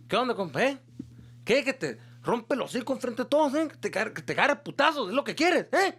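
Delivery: mock-furious father threatening to smack him; angry dad.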